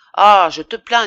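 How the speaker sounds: loud, scornful exclamation; scornful outburst. A narrator's reading. Woman answering her lover's plea of poverty, with loud scorn. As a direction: burst out with mocking pity. Scornful retort after his excuse.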